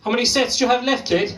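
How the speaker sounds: loud, high, rapid-fire pestering shout; calling out. Acted. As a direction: call it out high and fast to pester them. Loudly pestering through toilet door.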